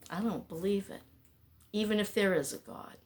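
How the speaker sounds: ordinary talk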